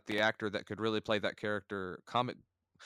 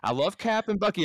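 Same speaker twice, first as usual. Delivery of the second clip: ordinary delivery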